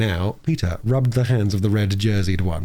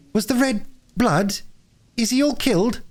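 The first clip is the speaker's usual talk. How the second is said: anxious little Phyllis; 14 semitones higher than usual. Acted. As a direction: ask anxiously in a high child's voice. Frightened child Phyllis asks if he's killed.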